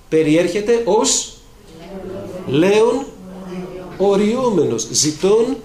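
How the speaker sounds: loud, high, slow booming sermon; preaching at full force. Acted. Preacher warning the congregation about the devil, booming. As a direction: thunder the warning out over the pews.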